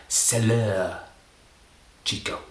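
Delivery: French phrase. Shown as a demonstration. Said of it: "I learnt the French".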